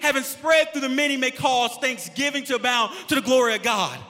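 loud, punchy preacher with stretched key words; full-force preaching. Real, not acted. Pastor telling sufferers there is a purpose, loud preaching. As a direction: drive each phrase home to the congregation.

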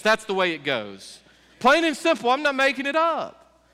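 loud, high fiery preaching. Acted. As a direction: hammer the point home to the crowd. Preaching at full force.

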